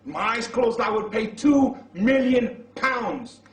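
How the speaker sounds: loud, slow, ringing vow; fervent vow. Acted. Husband vowing to his wife he'd pay any sum, loud and slow. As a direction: swear it with everything you have.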